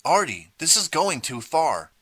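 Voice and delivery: choked, pleading protest; choked out plea. A narrator's reading. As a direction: force it out through a tight throat. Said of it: "finally choked out".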